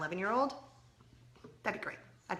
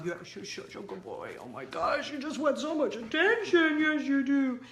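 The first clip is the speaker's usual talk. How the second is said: dog talk that climbs higher and louder; 6 semitones higher, 4 dB louder than usual. Real, not acted.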